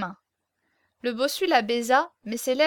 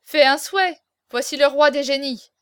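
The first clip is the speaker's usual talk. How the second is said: loud, raised teasing call; shouting; 2 semitones higher, 7 dB louder than usual. A narrator's reading. The band tells the hunchback to wish, shouting. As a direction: cry it out to goad him. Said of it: "cria la bande".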